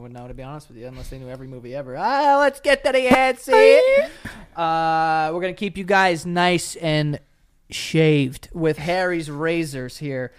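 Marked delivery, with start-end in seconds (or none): 2.0-3.8 high loud announcer call; hammy announcer. Acted. 4.6-10.3 upbeat sponsor read. Acted. Reads sponsor ad.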